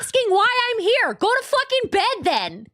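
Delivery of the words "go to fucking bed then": higher, drawn-out angry retort; fed-up dismissal with stretched words; irritated retort. Real, not acted. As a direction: tell them off, slow and high. Snapping back at chat.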